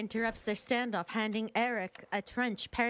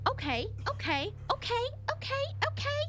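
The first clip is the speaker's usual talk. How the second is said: high, panicky okays climbing in pitch; shocked okays; 11 semitones higher than usual. Real, not acted. Streamer reeling after the shooting, high and panicky. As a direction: gasp them out in rising panic.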